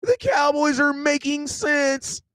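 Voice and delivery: waking up amazed at the Cowboys, shouted slowly; mock amazement. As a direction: stretch it out in mock shock. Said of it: "I wake up in the morning like".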